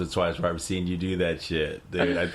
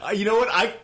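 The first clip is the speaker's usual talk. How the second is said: amused recollection; 11 semitones higher than usual. Real, not acted. Amused recognition, much higher.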